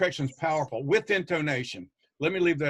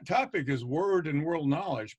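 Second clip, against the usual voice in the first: ordinary delivery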